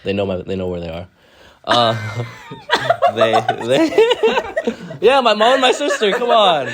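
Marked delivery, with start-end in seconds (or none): 1.6-6.7 high laughing talk; laughing while talking. Real, not acted. Laughing through shout-outs.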